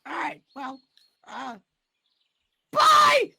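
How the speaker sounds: very high, loud squeaky voice; a flirty cartoon critter; Rocky voice. Rocky voice continues.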